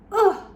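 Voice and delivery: loud, sudden hurt grunt; pained grunt. Acted. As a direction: groan as if struck. Ogre hit by the pencil.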